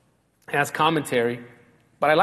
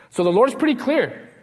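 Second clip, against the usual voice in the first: ordinary talk, nothing marked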